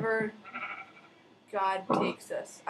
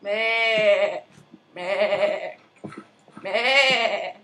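villager bleat; 4 dB louder, much slower than usual. Retold or imitated. Imitating the villagers.

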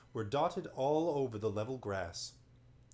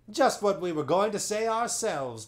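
high, loud chorus voice; chiming chorus; 9 semitones higher, 9 dB louder than usual. Acted. Chorus of monopods agreeing with the chief, eager chime. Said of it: "chimed the chorus".

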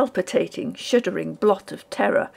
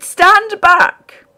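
even higher, loud shrieked "Stand back!"; desperate piercing yell; 10 semitones higher, 11 dB louder than usual. A narrator's reading. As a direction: scream it again, wilder. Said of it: "I shrieked".

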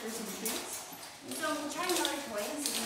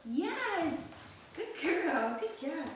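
high, louder praise for the dog; excited praise; 6 semitones higher, 4 dB louder, much slower than usual. Real, not acted. Trainer rewarding puppy's drop, excited. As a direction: cheer her on. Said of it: "keep your voice excited".

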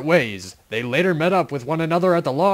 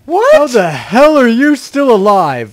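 shocked; 6 semitones higher, 7 dB louder than usual. Acted. Shocked at bullet news.

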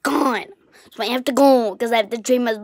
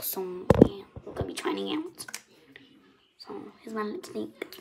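whisper; 10 dB quieter than usual. Acted.

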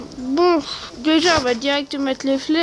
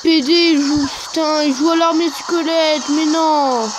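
furious shouting. Real, not acted. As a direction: yell in real frustration at the opponent. Raging at opponent's skeleton army.

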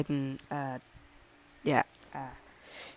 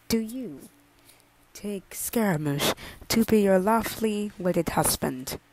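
normal talking voice

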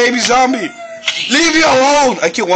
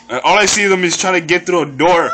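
laughing; 6 semitones lower than usual. Real, not acted. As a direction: laugh while you say it. Laughing at chaotic game.

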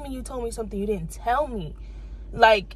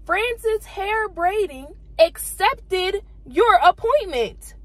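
slow, higher, mock-official reading; reading the confirmation; 7 semitones higher, 3 dB louder, much slower than usual. Vlogger reading the booking confirmation aloud, slow and mock-official. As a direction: read out the confirmation text slowly, higher up. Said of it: "It said".

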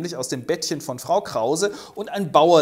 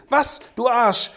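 slow, loud, high angry insult; furious outburst; 8 semitones higher, 7 dB louder than usual. Acted. Hoffmann insulting his old friend in a rage. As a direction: spit the insult out. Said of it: "Wutentbrannt stapft Hoffmann ab".

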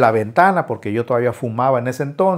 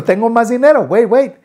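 frantic pleading; 8 semitones higher, 4 dB louder than usual. Retold or imitated. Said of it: "le digo".

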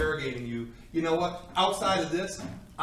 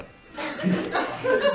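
laughing; 3 dB louder than usual. Real, not acted. Laughs at his own fallacy.

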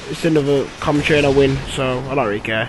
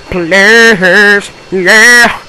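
loud pleading; 6 semitones higher, 7 dB louder, much slower than usual. Real, not acted. Begging viewers to subscribe.

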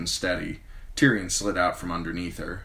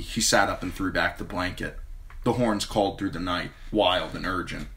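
ordinary delivery, nothing marked

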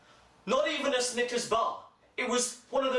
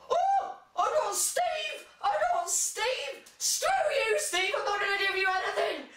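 gloating mock voice; 11 semitones higher than usual. Mocking a Secret Santa giver who gloats, very high. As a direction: crow over the name like a smug brat.

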